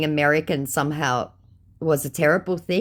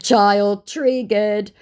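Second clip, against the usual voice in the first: slow taunt; 5 semitones higher, 5 dB louder, much slower than usual. Retold or imitated. Taunting the scammer.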